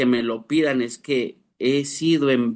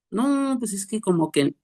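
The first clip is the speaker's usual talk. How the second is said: lazy hedging member; 8 semitones higher than usual. Retold or imitated.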